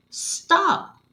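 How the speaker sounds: firm command; authoritative. Shown as a demonstration. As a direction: give the order with authority. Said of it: "read with an authoritative tone like this".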